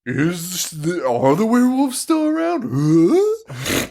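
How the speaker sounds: rising high, hammed-up fearful wondering; put-on scared cliffhanger question; mock suspense. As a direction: climb into an exaggerated spooked squeal. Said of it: "one more scare".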